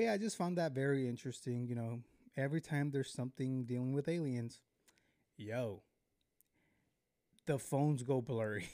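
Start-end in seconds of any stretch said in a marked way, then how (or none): none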